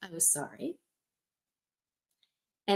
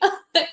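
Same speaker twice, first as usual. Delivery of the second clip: giggle; 10 semitones higher, 4 dB louder than usual. Real, not acted. Laughs at own story.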